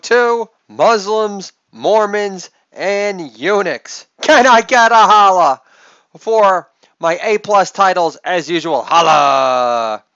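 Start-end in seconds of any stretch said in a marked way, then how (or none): 4.2-8.8 hype shout. Real, not acted. Show host hyping his episode titles. 8.8-10.0 loud, long falling "holla"; drawn-out holler. Real, not acted. Host answers his own call.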